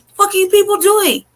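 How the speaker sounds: high-pitched protest yell, not full volume; retold yelling. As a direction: reenact hollering demands at the officers. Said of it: "I'm yelling, like".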